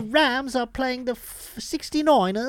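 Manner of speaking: mock Aussie presenter doing a footy rundown; mock Australian accent. Host parodying the Australian sports anchor, mock Australian accent. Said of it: "No, that's Brit-, that's English".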